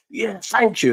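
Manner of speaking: high, chirpy thank you, lightly acted; eager thanks. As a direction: gratefully accept the praise. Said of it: "and I started, like".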